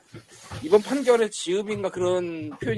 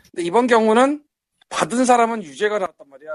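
to someone hard of hearing; 3 semitones higher, 8 dB louder than usual. Real, not acted. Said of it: "조금 가까이에서 말씀하셔야 될 것 같아요".

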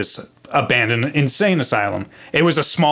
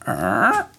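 vocal creak of a wooden step; creaking step noise. Retold or imitated. Stepping on an old stair in the dark, imitated creak. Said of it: "it just goes".

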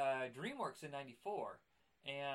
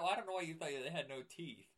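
ordinary talk, nothing marked

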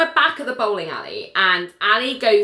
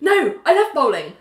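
hammed-up protest; 7 semitones higher, 3 dB louder than usual. Character desperately heads off dancing idea.

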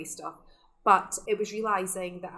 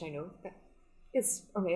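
ordinary talk, nothing marked

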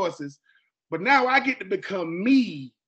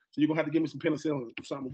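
usual speaking voice

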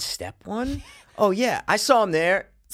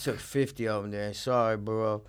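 low, soft, drugged mumble; doped-out guy; 4 semitones lower, 5 dB quieter than usual. Retold or imitated. Doped-out friend woken up mid-sale, groggy apology. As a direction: imitate a groggy man nodding back awake. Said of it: "never seen someone so doped out".

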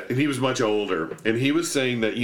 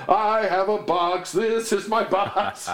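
slow, higher sing-song mock opera; mock opera line; 8 semitones higher, slower than usual. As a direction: sing it like a silly English opera. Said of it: "this guy going".

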